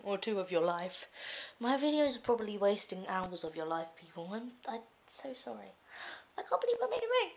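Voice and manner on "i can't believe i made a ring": high excited. Real, not acted. Proud shriek.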